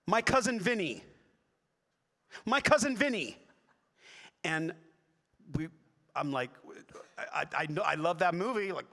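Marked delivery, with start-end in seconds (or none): 0.1-3.4 loud, high, aggressive shout; imitating a pushy, shouting fan. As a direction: yell it at a celebrity across a crowd. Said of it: "but kind of aggressive".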